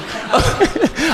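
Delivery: high-pitched cackle; high cackling laugh. Real, not acted. Big laugh.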